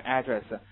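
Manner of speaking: "address" as AD-dress, first syllable stressed; American stress. Shown as a demonstration. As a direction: hit the AD in "address" with an open a. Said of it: "美国人会读成address". (美式读address，重音在第一音节)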